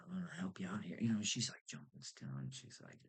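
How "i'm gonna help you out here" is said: whispered, hushed and conspiratorial. Retold or imitated. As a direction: lean in and whisper the favour. Cashier confides, retold.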